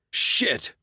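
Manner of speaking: high sharp shouted curse. A narrator's reading. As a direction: yell it in pain. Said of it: "Bask shouted".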